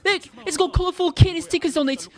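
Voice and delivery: protest, fast and excited; high excited protest. Acted. Character protests in shock.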